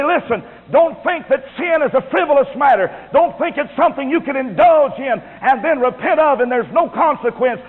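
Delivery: high-pitched, urgent preacher's warning; emphatic pulpit admonition; stern warning. Acted. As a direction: warn them sternly, pitched well up. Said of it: "this stern word to say".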